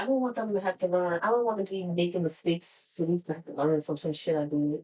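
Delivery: usual speaking voice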